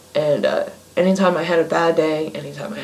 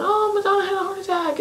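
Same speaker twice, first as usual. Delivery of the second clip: much higher, fast mock-shocked gossip; gullible gasp; 5 dB quieter, faster than usual. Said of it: "everybody's going".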